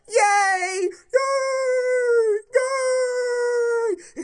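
high, loud sarcastic cheering; sarcastic shrieked yay; mock cheer. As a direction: squeal like a fake fan.

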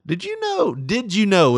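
very high chatty mother voice; mom's voice. Retold or imitated. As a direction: nag like a chatty old mom. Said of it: "my mom always starts off with".

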